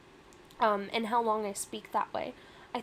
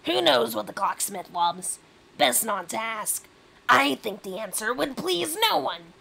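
loud, aggressive Rat King; 7 dB louder than usual. Acted. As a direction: dismiss the question forcefully as the rat. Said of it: "that is an aggressive voice".

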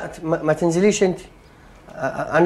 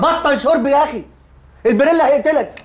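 irritated snap; 11 semitones higher than usual. Acted. Snapping at drunk friend.

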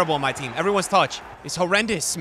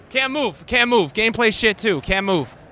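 ordinary delivery

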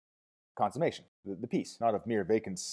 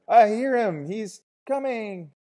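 high, loud shout with a drawn-out end; 13 semitones higher, 11 dB louder, slower than usual. A narrator's reading. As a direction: shout it out, stretching the last word. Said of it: "jumped up and shouted".

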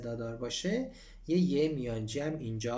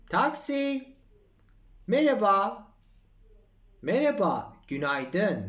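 acted dialogue; 8 semitones higher, 6 dB louder, slower than usual. Acts Mariana greeting taxi driver.

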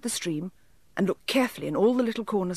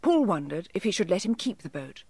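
usual speaking voice

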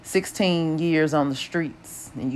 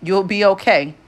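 louder, firm insistence on okay; 4 semitones higher, 4 dB louder than usual. A narrator's reading. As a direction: say it like a promise, no doubt. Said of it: "I repeated firmly".

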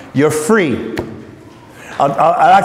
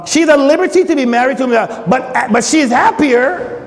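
rushes, then slows on happier; emphatic quote; 5 semitones higher than usual. Acted. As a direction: lean on happier playfully. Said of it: "He says here".

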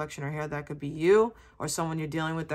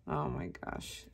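breathy under-the-breath exclamation; whispered oh my gosh; 13 semitones lower, 9 dB quieter, slower than usual. Real, not acted. Reader whispering at herself after laughing at her own slip. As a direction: mutter it as a whisper, amused at herself.

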